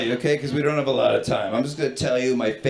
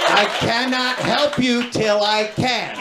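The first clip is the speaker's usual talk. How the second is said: high, sing-song rhyming recital; exasperated agent breaking into bouncy verse; pitched-up, metrical children's-book cadence; Doctor Seuss rhyme; 7 semitones higher than usual. Retold or imitated. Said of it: "just out of Doctor Seuss's new book".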